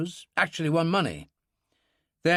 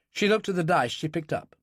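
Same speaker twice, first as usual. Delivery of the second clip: usual speaking voice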